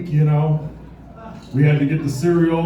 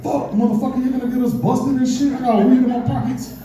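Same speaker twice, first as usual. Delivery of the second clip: high-pitched mock panic; nervous; 8 semitones higher than usual. Stoned teenager afraid of getting busted, nervous. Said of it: "So I start getting nervous".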